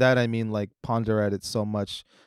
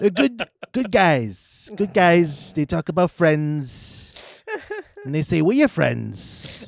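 high, slow, loud Trump voice; Trump impression; 7 semitones higher, 5 dB louder, slower than usual. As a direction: Trump on good guys. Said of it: "Trump impression continues".

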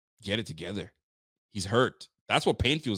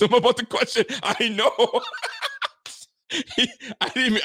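high, laughing agreement; cracking up while agreeing; 12 semitones higher than usual. Real, not acted. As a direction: say it through a burst of laughter. Cracking up at caller's rant.

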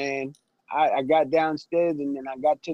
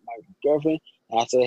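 ordinary delivery

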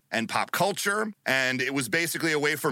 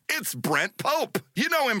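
slow, higher, drawn-out host reveal; announcer reveal; 9 semitones higher than usual. Acted. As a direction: present him like a game show announcer.

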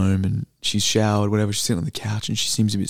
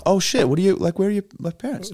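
high, sudden surprise; surprised; 9 semitones higher than usual. Real, not acted. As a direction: jolt up in pitch, caught off guard. Surprised learning Samoan parents.

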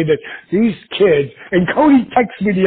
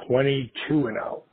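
ordinary delivery

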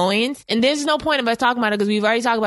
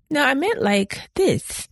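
slow mock clarification; 4 semitones higher, much slower than usual. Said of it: "I'd be like".

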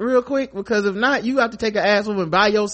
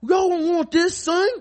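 high, loud mock New York tough guy; hammed-up street threat, pitched up; posturing bravado, high and loud; tough New Yorker; 10 semitones higher, 3 dB louder than usual. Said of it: "He was like".

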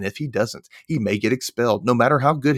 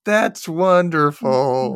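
slow, higher, tearful fake cheer; mock holding back tears; 6 semitones higher, 3 dB louder, much slower than usual. Friend imitates a girl fighting back tears, slow and strained. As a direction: force out the words through held-back tears. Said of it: "Holding back tears".

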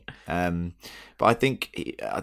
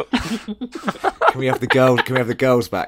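higher, louder pleading through laughter; laughing plea; 4 semitones higher, 6 dB louder than usual. Real, not acted. Begging the others to stop the puns, laughing. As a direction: beg them to stop while cracking up.

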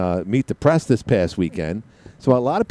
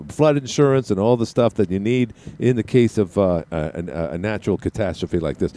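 normal talking voice

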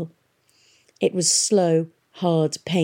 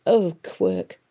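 usual speaking voice

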